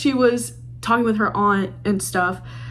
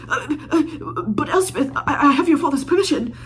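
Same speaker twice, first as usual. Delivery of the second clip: stammering, higher mock protest; flustered suitor; 5 semitones higher than usual. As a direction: stumble over the words in a high put-on voice. Mocking rejected suitor Alex protesting.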